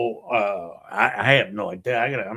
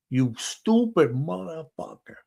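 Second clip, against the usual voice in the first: acted scolding; 6 semitones higher, slower than usual. [name] scolds Hank on phone.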